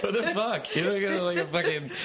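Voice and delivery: high giggling disbelief; squeaky laughing ridicule. Real, not acted. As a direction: laugh through the words. Laughing at the forum commenter.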